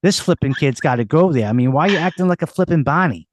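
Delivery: hammed-up Boston local; thick put-on Boston accent, street-corner chatter. Shown as a demonstration. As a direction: rattle on like a guy from Boston. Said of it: "Boston, take, take one, action".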